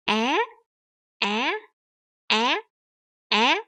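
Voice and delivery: AH swept sharply upward; rising tone. Shown as a demonstration. Teacher drills the tone mark, AH with a rising tone. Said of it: "you put the rising tone over that".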